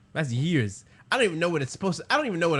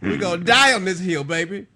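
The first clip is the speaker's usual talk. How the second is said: high loud shouting stubborn voice; angry shout; 6 semitones higher, 4 dB louder than usual. Acted. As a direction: yell defiantly.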